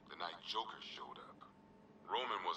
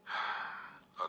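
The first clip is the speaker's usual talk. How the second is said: weary sigh. Acted. Cop sighs over Deadshot's release.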